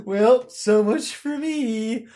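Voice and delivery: Droopy Dog. Acted. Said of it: "He's like fucking droopy dog".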